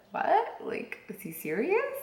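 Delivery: high-pitched retold disbelief; incredulous. Wife retelling her reaction to husband's coop idea, incredulous.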